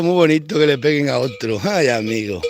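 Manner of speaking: normal talking voice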